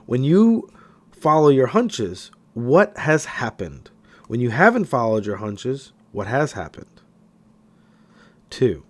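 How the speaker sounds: ordinary delivery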